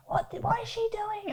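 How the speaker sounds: high, put-on voice of the annoyed driver; mock driver. Imitating the driver who hit her, high and mocking. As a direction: squeak out the stranger's complaint.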